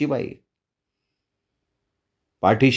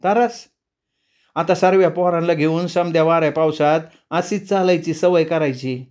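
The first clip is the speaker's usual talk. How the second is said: much higher, rising on the first word; eerie village woman; 4 semitones higher than usual. Acted. The woman answers the traveller, high and strangely calm. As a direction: speak with an odd calm.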